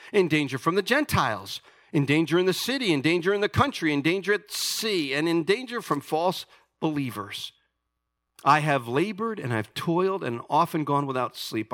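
fiery preacher reading scripture; preaching at full force. Acted. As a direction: proclaim Paul's boasts like a challenge. Reads Paul's boasts with force.

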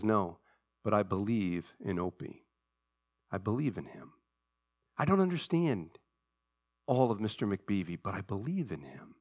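quiet tender. Retold or imitated. Andy quietly defends his son.

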